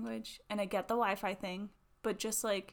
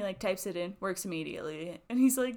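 ordinary talk

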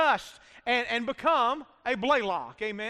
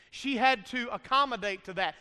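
normal talking voice

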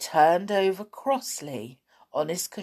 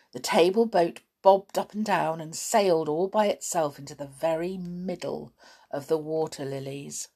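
ordinary delivery

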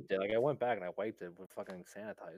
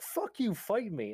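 high, raised mock yell; angry challenge; 10 semitones higher, 4 dB louder, slower than usual. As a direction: act out a man spoiling for a fight. Retelling the angry guy's challenge.

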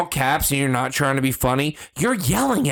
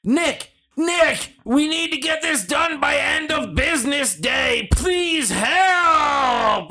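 mock all-caps plea; 10 semitones higher, slower than usual. Mocking a coworker's urgent all-caps email, shouted. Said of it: "all caps email".

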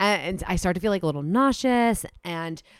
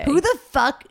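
high, loud incredulous outburst; can't believe what she's hearing; outraged disbelief; 9 semitones higher, 5 dB louder, slower than usual. Real, not acted. Friend hearing about the contract, shocked outburst.

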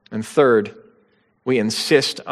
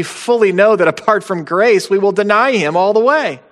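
higher, louder sermon drive; preaching at full force; 7 semitones higher, 4 dB louder than usual. Acted. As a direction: build the exhortation with fervor. Preacher building to climax.

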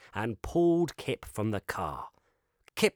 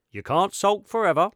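higher, louder scolding father voice; stern dad; 10 semitones higher, 9 dB louder than usual. Acted. Dad twisting round to scold sulking Kip. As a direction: tell the boy off from the front seat.

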